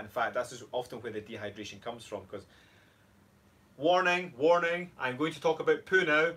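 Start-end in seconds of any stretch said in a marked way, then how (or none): 3.8-6.3 louder, higher alarm announcement; mock warning. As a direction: sound a playful public alert. Mock alert before poo talk.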